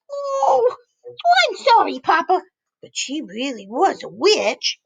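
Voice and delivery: high sorry chick; little chicken voice. Acted. Chick apologizing to Papa, pitched high. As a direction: apologize high, then insist. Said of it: "chicken apologizes".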